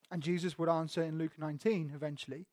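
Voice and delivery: usual speaking voice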